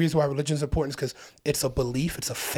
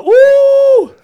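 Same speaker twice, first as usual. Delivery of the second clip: high, loud ooh of shock; stunned ooh; 17 dB louder than usual. Retold or imitated. Reacting when the ghost corrected him, high shocked ooh. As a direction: squeal it out in disbelief. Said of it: "And I was like".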